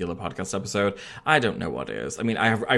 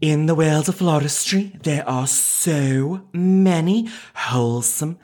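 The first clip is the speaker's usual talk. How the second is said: slow, drawn-out Scouse podcast intro; Scouse accent; 4 semitones higher, slower than usual. Acted. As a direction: savour each word in put-on Scouse, pitched up. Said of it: "just fully in Scouse".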